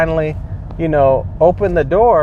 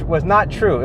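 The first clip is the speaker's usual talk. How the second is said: normal talking voice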